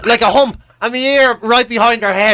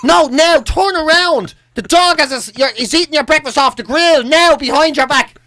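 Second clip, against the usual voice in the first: mock yelling mother; 7 semitones higher than usual. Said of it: "my ma standing in front of him going".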